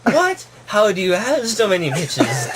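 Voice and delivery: mock amazement, jumping high on "What"; high-pitched disbelief in a story voice; amazed. Puppy asking mentor about his companions, amazed. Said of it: "Toby asked in amazement".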